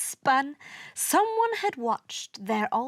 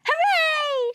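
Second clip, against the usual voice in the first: loud, very high whoop; shouted cheer; 5 dB louder than usual. A narrator's reading. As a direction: cheer with glee. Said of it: "shouted Venus".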